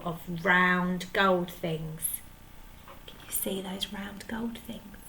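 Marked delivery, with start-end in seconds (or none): 3.1-5.0 hushed aside to the listener; whisper. Real, not acted. Narrator whispering a question to the child. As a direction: whisper it like a shared secret.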